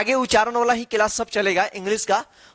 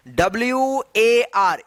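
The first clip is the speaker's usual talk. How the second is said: letter by letter, slowly; slow spelling out. Shown as a demonstration. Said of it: "इसको क्या पढ़ेंगे?". (धीरे-धीरे एक-एक अक्षर बोलना)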